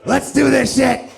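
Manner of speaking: high, loud, drawn-out battle cry; hammed-up hyped holler; amped-up trucker shout. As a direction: yell it like a wired truck driver. Meth trucker hyping himself up.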